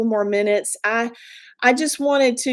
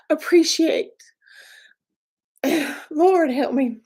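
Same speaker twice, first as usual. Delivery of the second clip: emotional; 6 semitones higher than usual. Real, not acted. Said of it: "I'm an emotional person".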